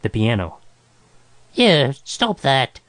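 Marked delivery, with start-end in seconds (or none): 1.5-2.8 drawn-out screech of protest; acted outraged cry. A narrator's reading. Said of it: "Groverbs screeched".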